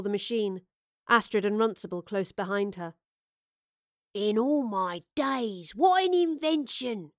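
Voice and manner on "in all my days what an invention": slow, then a rising higher amazed cry; awed call. Acted. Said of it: "Runcible called".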